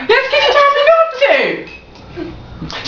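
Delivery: high, slow mock-indignant protest; indignant squeal. Real, not acted. Friend protesting to friend who laughed at her, high indignant squeal.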